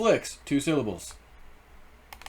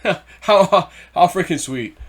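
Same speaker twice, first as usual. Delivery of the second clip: laughing delight; 5 semitones higher, 7 dB louder, slower than usual. Real, not acted. Excited about earning fuel.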